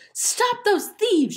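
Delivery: very high, loud, drawn-out scream. A narrator's reading. Ellen chases the thieves, high loud scream. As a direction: scream it slowly for help. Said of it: "she screamed".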